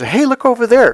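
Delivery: high, loud, bright call to look away; sudden distracting call. Shown as a demonstration. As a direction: yank attention away with a sudden pointing call. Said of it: "The first thing you do is".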